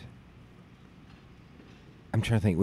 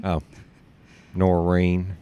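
put-on a light Southern accent on the name Noreen; much slower than usual. Saying the co-host's mother's name in a Southern accent. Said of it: "That was a good Southern accent".

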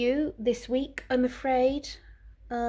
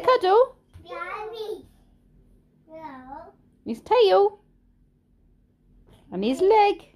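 put-on high baby talk; 11 semitones higher than usual.

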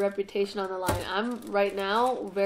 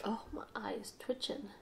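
whispery congested voice; 13 dB quieter than usual. Real, not acted. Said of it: "that sultry voice she has".

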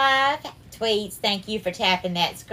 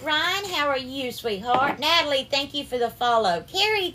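ordinary talk, nothing marked

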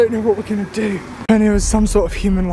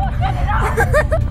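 high squealing laugh; shrill laugh. Real, not acted. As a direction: burst into a shrieking giggle. High laugh after shriek.

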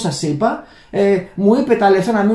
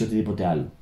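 ordinary delivery

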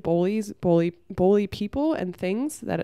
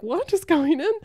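bewildered question; 9 semitones higher, 4 dB louder than usual. Retold or imitated. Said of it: "she was also like".

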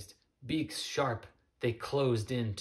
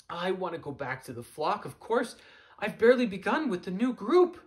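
put-on higher young voice, keen and sure; lightly voiced eager student; young gull Fletcher, eager; 7 semitones higher, 4 dB louder than usual. Fletcher telling Jonathan he will go back, eagerly.

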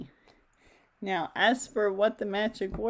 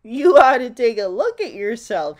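loud, lightly voiced amused retort; laughing Mister Cheerful; 9 dB louder than usual. A narrator's reading. Mister Cheerful teases Little Miss Bossy, loud amused retort. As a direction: say it loudly after a chuckle.

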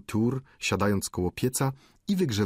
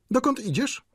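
very high-pitched woman's voice; landlady's question. A narrator's reading. Landlady questioning her servant, high-pitched voice. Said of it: "zapytała go pani Wauquez".

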